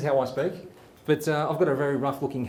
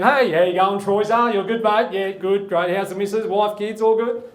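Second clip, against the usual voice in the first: louder put-on goofy chatty voice; stupid voice; 5 semitones higher, 6 dB louder than usual. Carpenter greeting Troy in his stupid put-on voice. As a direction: ham up a silly cheerful bloke. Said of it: "put this stupid voice on now when I work with Troy".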